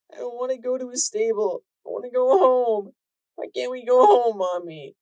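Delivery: put-on high, loud whining child voice; a foal begging to go home; pleading little filly. Filly begging her mother to go home, high and whiny.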